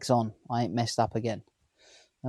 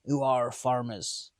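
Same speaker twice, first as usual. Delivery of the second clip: slow, drawn-out West Country farmer voice; mock Somerset accent; slower than usual. Londoner imitating his Somerset neighbours, mock West Country accent. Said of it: "accent's completely different. They sound like".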